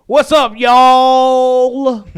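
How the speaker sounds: loud, high, drawn-out Southern drawl; fake country accent. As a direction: ham up a fake Southern accent, stretching every vowel. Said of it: "he put on that fake country accent".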